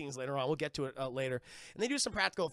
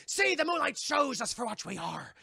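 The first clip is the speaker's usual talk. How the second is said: raised, loud theatrical pirate voice; Barbossa impression; 6 semitones higher, 5 dB louder than usual. Pirate captain reveals the curse, hammed-up proclamation. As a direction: declaim it like a villainous pirate captain. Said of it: "Captain Barbossa, he comes out".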